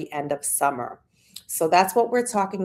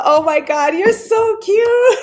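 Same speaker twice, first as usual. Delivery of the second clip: high, loud and slow, then a giggle; squealing you're so cute, laughing; delighted squeal; 13 semitones higher, 9 dB louder than usual. Real, not acted. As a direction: melt at a sweet comment. Viewer compliment delights her.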